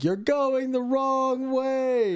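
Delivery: high, long-stretched mock holler; drawn-out warning cry, no real force; imitated yelling. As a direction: call it out like a far-away motorist. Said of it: "keep yelling at them".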